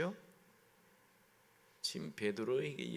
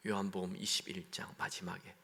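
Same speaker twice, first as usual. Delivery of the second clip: whisper; 5 semitones lower, 5 dB quieter than usual. Real, not acted. Preacher drops to aside.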